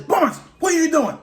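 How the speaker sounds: high, sharp mock boss voice; boss calling out. Supervisor asking the inmate what he's doing, in a high mock voice. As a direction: call across the kitchen like a puzzled supervisor. CEO questions him in kitchen.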